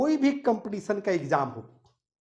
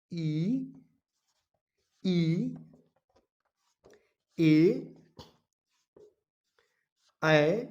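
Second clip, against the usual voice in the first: each vowel said slowly with long pauses; slow vowel dictation; 3 semitones lower, much slower than usual. Shown as a demonstration. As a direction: dictate the vowels one by one for students to write. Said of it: "मैं कह रहा हूं कि आप लोग कलम किताब निकालो और लिखो". (धीरे-धीरे एक-एक स्वर बोलकर लिखवाना)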